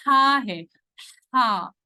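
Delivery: ہا breathed from the throat like after eating mints; a little air from the throat on ہا; throaty ha. Shown as a demonstration. Teacher demonstrating the throat ha to the class. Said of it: "حلق میں سے تھوڑی سی ہوا نکلے گی".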